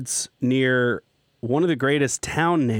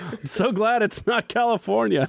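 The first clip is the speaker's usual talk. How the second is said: laughing, higher mock ad slogan; tagline; 9 semitones higher than usual. Host teasing colleague with a mock slogan, amused tagline. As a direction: say it like a product slogan through amusement. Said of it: "like, I can't believe it's not butter".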